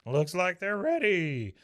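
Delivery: slow, loud, high race-caller shout; announcer. Retold or imitated. Tug calling the start of the race, announcer voice. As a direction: stretch it out like a track announcer. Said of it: "you hear the announcer or Tug say".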